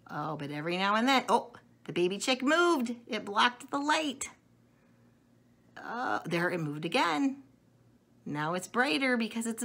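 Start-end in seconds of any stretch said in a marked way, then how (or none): none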